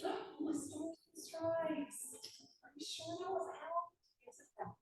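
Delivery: high, slow, soft sugary disbelief; fake-sweet southern lady. Player questioning a line call in sugary high voice. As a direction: play dumb sweetly, drawn out. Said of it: "I start asking".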